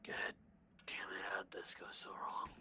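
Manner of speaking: whispered in despair; whispering. Real, not acted. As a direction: breathe it out, defeated. Player despairing at the situation.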